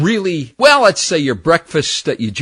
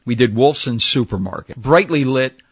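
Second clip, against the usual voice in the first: usual speaking voice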